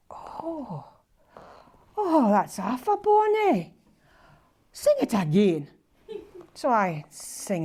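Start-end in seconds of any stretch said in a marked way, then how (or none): 0.1-5.7 put-on a touch of an old Scots father, slow and higher; lightly voiced dad, moved and admiring; imitating her dad. Her father asking her to sing again, lightly imitated.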